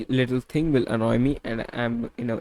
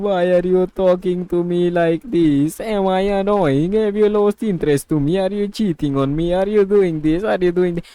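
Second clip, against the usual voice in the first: higher, louder, rapid-fire upset questions; retold tearful pleading; 5 semitones higher, 4 dB louder than usual. Retelling the missus crying on the phone, agitated and pleading. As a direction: imitate an upset girlfriend piling up accusations. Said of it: "while my missus were crying on the phone".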